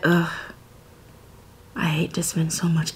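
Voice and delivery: breathy whisper of disgust, unvoiced; whispered hate. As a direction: whisper it like a secret grudge. Quoting own reaction to Dion.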